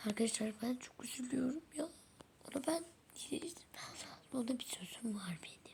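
hushed aside to the audience; whispered aside to viewers about the sick doll. Real, not acted. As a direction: whisper it so the doll won't hear.

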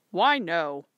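firm father. Acted.

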